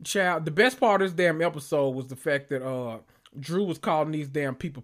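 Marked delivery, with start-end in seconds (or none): none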